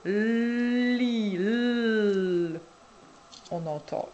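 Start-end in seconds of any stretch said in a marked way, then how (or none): 0.1-2.6 "Li" stretched, then "L" held alone as a long llll; sounded-out consonant. Shown as a demonstration. Showing the child the consonant, "Li" then "L" held long. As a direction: draw out "Li", then isolate the sound of "L". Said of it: "Il te faut une consonne".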